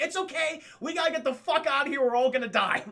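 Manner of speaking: high, loud mock panic; hammed-up urgent rallying; panicked urging. Urgent panic in retold escape.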